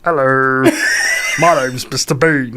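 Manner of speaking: slow, mumbling Bean voice; Mister Bean voice. Acted. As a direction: play Mister Bean introducing himself. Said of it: "Do the Bean again".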